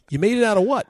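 can't believe the boat's material; incredulous. Retold or imitated. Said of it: "And we're like".

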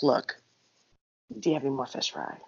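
imitating mom's discreet plea, kept low; hushed aside. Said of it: "my mom was whispering to her".

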